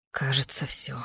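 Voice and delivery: very soft, resigned whisper; tragic whisper. Acted. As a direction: breathe it out in despair. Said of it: "трагично прошептал Финист".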